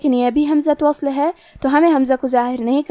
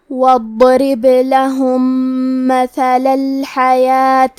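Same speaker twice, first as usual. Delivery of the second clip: slow, melodic chanted recitation; Quran recitation; much slower than usual. Acted. Teacher recites the full passage to students, slow melodic tajweed. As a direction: chant the verses slowly and reverently.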